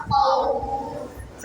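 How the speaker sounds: खौफ with the vowel drawn out long, three or four alifs; stretched pause form. Shown as a demonstration. Teacher demonstrates stopping on खौफ with long vowel. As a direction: hold खौफ slowly, ending on a still फ. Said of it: "वक़फ़".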